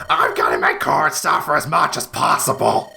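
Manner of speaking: lightly voiced comic villain; a hint of squawking parrot sidekick; Gilbert Gottfried impression. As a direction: join in with a milder mock villain voice. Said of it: "he did it as Gilbert Gottfried's voice".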